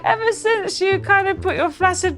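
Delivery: coy and giggling, very high; sweet lie. Retold or imitated. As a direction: Catherine sweet-talking Henry. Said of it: "she's like".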